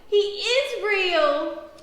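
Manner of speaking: loud, high, very drawn-out exclamation; excited cry. A narrator's reading. Lark discovers the puppy is real, crying out. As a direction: stretch it out in amazed delight. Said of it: "cries Lark".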